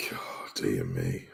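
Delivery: low, slow whisper of disbelief; whispered dismay. Real, not acted. Reacting to a bathwater joke, slow whispered dismay. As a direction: breathe it out, appalled.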